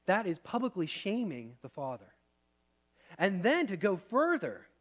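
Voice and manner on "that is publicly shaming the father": ordinary talk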